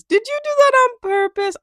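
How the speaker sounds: playful high accusation; mock accusing squeal. Real, not acted. Teasing repeat, high and loud.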